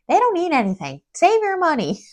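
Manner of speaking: high-pitched nagging daughter, retold; playful high-pitched scolding of a parent; mock exasperation. Retells exasperated daughter nagging her mom.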